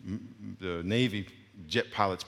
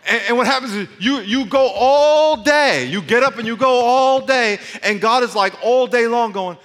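loud, high-pitched rant, drawing out ALL DAY; frantic loud rush; 11 semitones higher, 12 dB louder than usual. Acted. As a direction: shout it at full tilt like a nonstop day. Preacher acting out a busy day.

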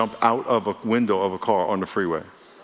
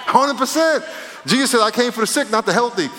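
fervent preaching; 10 semitones higher than usual. Acted. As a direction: raise it up with preaching fire. Preacher rising to fervor.

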